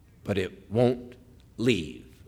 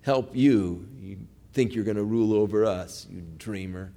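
low, softer dismissive taunt; contemptuous brush-off; 7 semitones lower, 7 dB quieter than usual. Retold or imitated. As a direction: shrug him off with quiet scorn. Brothers mock Joseph being led off.

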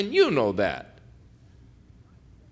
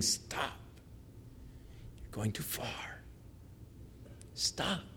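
near-whispered voice of God; hushed warning; 19 dB quieter, slower than usual. Acted. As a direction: warn very softly, almost under the breath.

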